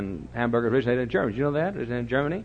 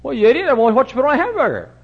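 high, loud, fast retort; mock annoyance; 10 semitones higher, 7 dB louder, faster than usual. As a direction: snap back at the silly question.

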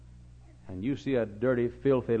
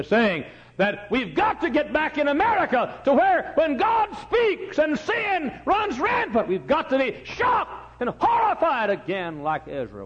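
much higher, louder, slowed and shouted; full-force preaching; 7 dB louder, slower than usual. Real, not acted. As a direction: thunder it from the pulpit.

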